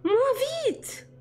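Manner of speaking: high cry of alarm; terrified squeal. A narrator's reading. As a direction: urge it in a high, frightened voice. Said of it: "elle piaillait de terreur".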